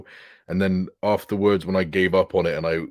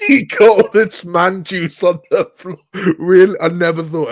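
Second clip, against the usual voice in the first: incredulous teasing; 11 semitones higher, 11 dB louder than usual. Acted. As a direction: throw their words back, scandalised and amused. Teasing friend's words back in disbelief.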